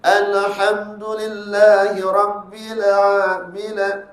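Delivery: mock the styled dragging read; dragging recitation. Shown as a demonstration. Teacher demonstrates the wrong dragging recitation. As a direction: stretch the vowels, slow and drawn out. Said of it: "suka menggayakan bacaan gitu".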